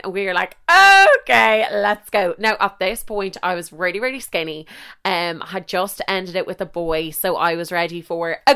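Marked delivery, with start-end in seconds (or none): 0.7-2.3 excited. Retold or imitated. Friends agreeing to go to Carrick-on-Shannon, excited loud yes. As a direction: jump at the plan.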